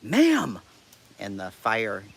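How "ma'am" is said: high, loud call over a speaker; loudspeaker shout. Retold or imitated. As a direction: bark it through a megaphone. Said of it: "on the speaker".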